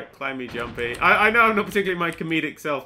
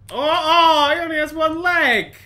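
high, loud exclamation; shocked discovery; 7 semitones higher, 8 dB louder than usual. Real, not acted. Player seeing the spider's one leg, shocked cry. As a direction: cry out at what you see.

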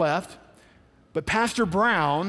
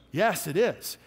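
ordinary talk, nothing marked

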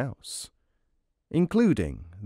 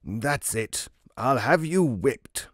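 growled; 3 semitones higher than usual. Acted. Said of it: "the judge growled".

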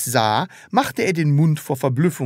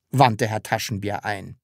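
usual speaking voice

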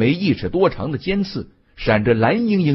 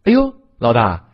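startled exclamation to the boss; taken aback; 4 dB louder than usual. A narrator's reading. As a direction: yelp in surprise, then address the boss. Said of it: "库伯吓了一跳".

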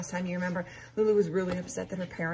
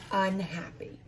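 pouty; slower than usual. Acted. Lulu storming off upstairs.

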